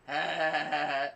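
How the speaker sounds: loud spelled-out ha ha; mock laughter. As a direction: laugh each ha deliberately. Acting out own laugh.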